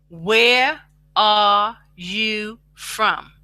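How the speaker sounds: every word stretched out slowly; a very slow model line for learners; slow, drawn-out demo. Shown as a demonstration. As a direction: spell it out slowly for the class. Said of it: "You can speak it out".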